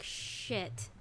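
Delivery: unvoiced whispered curse. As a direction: whisper the curse under your breath. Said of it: "I was like".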